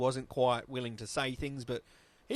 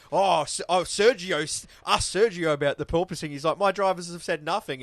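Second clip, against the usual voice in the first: loud, higher whinging imitation; mock whining Toto; 3 semitones higher, 6 dB louder than usual. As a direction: whinge it loudly like a sore loser. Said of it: "And he's going".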